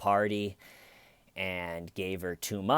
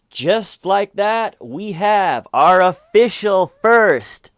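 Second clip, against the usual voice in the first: slow, much louder, higher, like a game-show host; mock grand announcement; 10 semitones higher, 15 dB louder, slower than usual. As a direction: build it up as a big reveal. Announcing the first lie cry.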